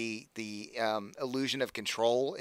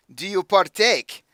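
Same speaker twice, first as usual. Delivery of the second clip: higher, louder, slow put-on accent; accented villain offer; 7 semitones higher, 9 dB louder, slower than usual. Patron offering her his drug, slow accented tease. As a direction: drawl the offer with menace. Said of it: "Doing this voice is gonna kill me".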